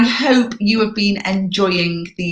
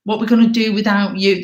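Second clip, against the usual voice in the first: mock pleading, pitched up; begging, guilt tripping; 4 semitones higher than usual. Guilt trippers begging her to chair, acted higher-pitched pleading. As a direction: beg as a persistent guilt tripper would. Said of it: "they might start begging you and guilt tripping you".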